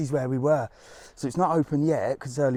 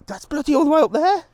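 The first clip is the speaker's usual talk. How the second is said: loud, high-pitched astonishment; amazed disbelief; 13 semitones higher, 8 dB louder than usual. Real, not acted. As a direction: exclaim it in real disbelief. Amazed by passer-by's long walk.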